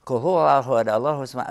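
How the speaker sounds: quick, run-together Arabic recitation; hurried recitation. Retold or imitated. Friend just giving the meaning in the car, hurried recitation. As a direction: imitate a friend rushing through the verse. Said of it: "No, no, no, no, go slow".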